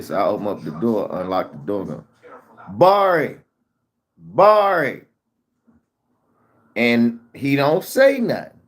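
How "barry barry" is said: loud worried call of the name. Retold or imitated. Calling out for his son on coming home, loud and worried.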